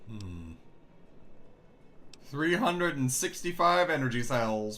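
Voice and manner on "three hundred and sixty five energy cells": excited. Real, not acted. Excited over loot.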